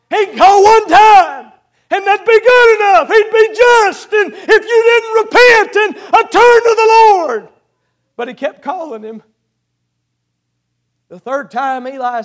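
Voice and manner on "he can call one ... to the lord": loud, higher preaching cry; preaching at full force. Acted. Preacher to congregation, loud fervent cry. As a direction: drive home God's patience.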